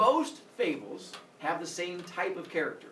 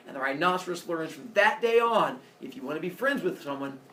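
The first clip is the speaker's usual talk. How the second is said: ordinary talk, nothing marked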